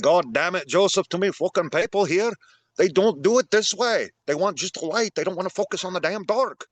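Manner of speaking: a hint of a gruff old Irish priest ranting; Franklin O'Reilly, old school Irish. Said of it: "old school Irish".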